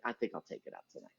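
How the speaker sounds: low, soft, quick mumble; sheepish mumble. Retold or imitated. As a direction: mumble it like backing down. Fellow comic quietly conceding.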